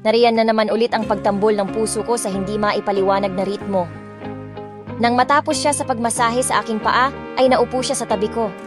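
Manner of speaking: ordinary delivery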